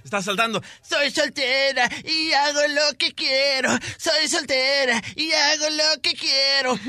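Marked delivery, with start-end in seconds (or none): 0.8-3.7 put-on toothless old lady. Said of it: "está una viejita, bien ruquita". 4.0-6.8 put-on toothless old lady. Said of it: "está una viejita, bien ruquita".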